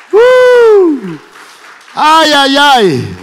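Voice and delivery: high loud ecstatic "uh" then "ay ay ay"; ecstatic cry. Real, not acted. Preacher crying out in awe to the congregation. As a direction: cry out in holy excitement.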